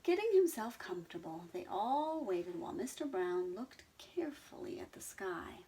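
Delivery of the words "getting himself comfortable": plain reading voice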